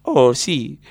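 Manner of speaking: usual speaking voice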